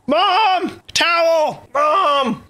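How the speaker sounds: real high-pitched yell for help; urgent shout. Real, not acted. Streamer who just choked on marshmallows calls mom, urgent shout. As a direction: call out in a panic to another room.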